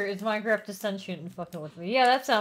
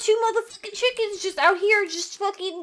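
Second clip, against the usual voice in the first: loud, high-pitched exasperated rant; frustrated outburst; 7 semitones higher, 7 dB louder than usual. Real, not acted. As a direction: burst out in baffled frustration. Venting about game's spawning glitch.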